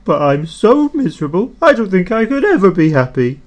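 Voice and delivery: a hammed-up woeful moan; miserably. A narrator's reading. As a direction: whine it in self-pity. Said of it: "he added miserably".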